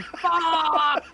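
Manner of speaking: high, loud cursing yell, laughing; re-enacted scream of pain and anger; retold pained scream. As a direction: yell it out like you just fell on something. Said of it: "so I was screaming".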